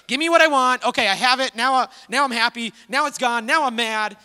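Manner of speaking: mock tantrum of a little kid; whiny toddler. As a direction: play a toddler grabbing a toy then sulking. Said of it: "like a toddler who's just like".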